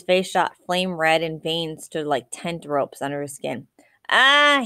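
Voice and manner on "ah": sudden furious yell, high and loud; wordless shout of rage; angry yell. A narrator's reading. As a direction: let out a raw startled roar. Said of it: "he yelled".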